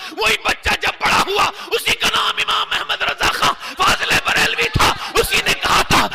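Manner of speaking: high, loud proclaiming of the name; preaching shout. Acted. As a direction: call it out to the hall.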